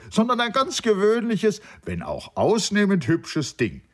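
high-pitched, animated playful dismissal; teasing debunking. A narrator's reading. Nanda tells his friend she is an ordinary girl, high teasing tone. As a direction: bring the goddess down to earth, voice lifted. Friend deflates lover's idol in story.

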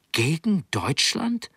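high, slow, disbelieving rise; shocked question. A narrator's reading. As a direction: ask it in disbelief, drawn out. Boy shocked by the news.

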